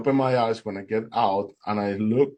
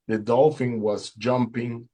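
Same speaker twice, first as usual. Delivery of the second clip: usual speaking voice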